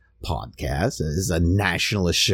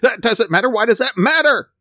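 loud, very high-pitched hysterical indignation; mock outraged protest; 6 dB louder than usual. Imitating civic nationalists asked about their members, mock outrage. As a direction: shriek it as a hammed-up offended objector. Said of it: "they would jump up and say".

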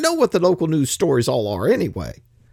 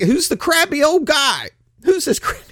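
mocking fan; 7 semitones higher than usual. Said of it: "you're gonna be like".

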